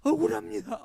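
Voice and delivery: high, loud, crying wail; sobbing. Retold or imitated. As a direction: cry it out in despair. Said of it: "하고 엉엉 울면서".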